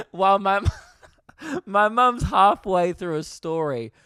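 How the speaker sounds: talking through real laughter; giggly words through a laugh. Real, not acted. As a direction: crack up while telling it. Laughing at his own story.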